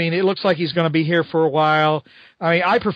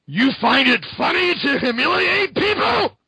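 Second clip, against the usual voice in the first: Spider-Man voice; 7 semitones higher than usual. Acted. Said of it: "he goes".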